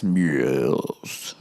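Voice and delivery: low, soft butler voice; deep butler. Pretend. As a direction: answer like a stiff butler summoned by a bell. Butler answering the bell.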